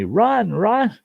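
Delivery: high-pitched retold yell of run; mock yell. Trucker fleeing wasps yells at narrator, mock panicked shout. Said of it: "starts yelling at me".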